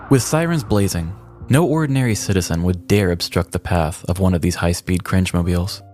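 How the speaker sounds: normal talking voice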